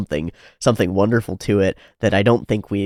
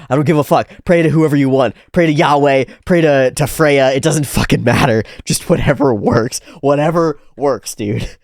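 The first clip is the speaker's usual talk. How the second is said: loud, careless mock rant, higher; hammed-up whatever attitude, louder; imitating the author's don't-care attitude; 6 semitones higher, 7 dB louder than usual. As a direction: brush it all off like a shrugging writer. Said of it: "But he's like".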